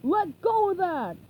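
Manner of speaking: furious pirate; angry pirate shout. Acted. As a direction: roar it at the bird. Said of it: "Burger Beard shouted".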